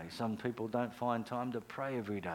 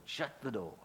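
usual speaking voice

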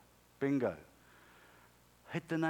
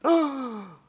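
loud, high mock gasp; shocked gasp; 12 semitones higher, 11 dB louder than usual. [name] hearing God tell him to shut up, exaggerated shocked gasp.

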